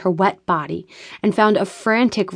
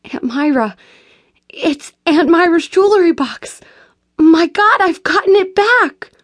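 high, loud burst of tearful joy; a gasp of joy rising to a shout; overjoyed cry; 10 semitones higher, 5 dB louder than usual. A narrator's reading. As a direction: cry out in disbelieving delight. Tearful joy at returned heirloom.

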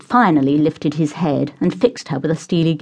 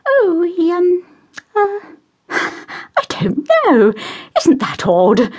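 high, gushing society matron; high-society lady voice; 3 dB louder, slower than usual. Acted. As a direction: play the fussy posh mother, sing-song high. Mother voiced.

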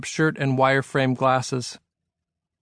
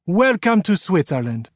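put-on higher, French-accented welcome; 5 semitones higher than usual. A narrator's reading. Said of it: "in a French-sounding accent".

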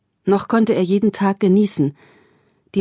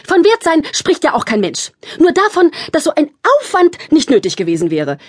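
much higher, louder indignant snap; shrill retort; 8 semitones higher, 4 dB louder than usual. A narrator's reading. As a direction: snap back sharply. Sisters quarrel, Thekla retorts.

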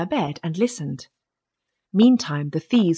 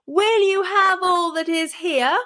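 high, drawn-out call; bawling; 12 semitones higher than usual. A narrator's reading. Tom calls from inside the house to the thieves, bawling. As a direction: bawl it out slowly, high-pitched, on a held tone. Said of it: "as loud as he could bawl".

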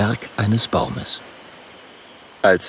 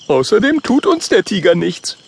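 higher voice, stress on uns; boastful Oskar; 10 semitones higher, 4 dB louder than usual. Acted. Oskar reassures friends about tiger, higher boastful voice. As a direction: brag with a higher voice. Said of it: "sagt Oskar".

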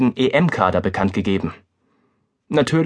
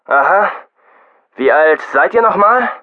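condescending; 5 semitones higher, 6 dB louder than usual. A narrator's reading. Press officer, condescending.

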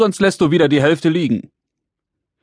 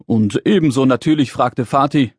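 ordinary delivery

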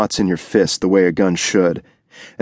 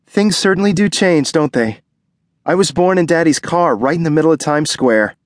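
put-on higher young wife's voice, wistful; higher wife's voice, musing; Ruby's voice; 9 semitones higher than usual. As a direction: recall the past as the pregnant wife, lightly.

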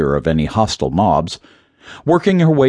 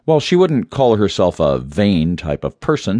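ordinary delivery

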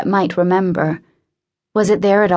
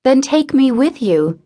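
higher, rushed blurted plea; 6 semitones higher than usual. A narrator's reading. Woman begging the departing man, blurting it out. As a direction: let it burst out before she can stop. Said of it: "she blurted out".

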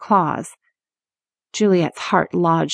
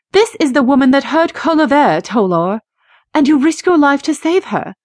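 higher, louder, icy reproach; cold accusation; 7 semitones higher, 4 dB louder than usual. Acted. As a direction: accuse him coldly. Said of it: "her voice as cold as her eyes".

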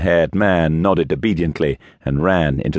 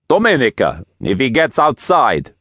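put-on higher-pitched accented call; 6 semitones higher than usual. A narrator's reading. Gang boss calls orders to his henchwoman, raised voice. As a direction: call an order across the church. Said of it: "he called".

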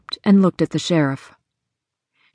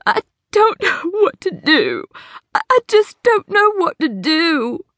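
high, broken sobbing into her hands; 11 semitones higher than usual. Acted. Hattie breaks down before the sheriff, sobbing. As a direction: sob it out, face in your hands. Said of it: "buried her head in her hands and sobbed".

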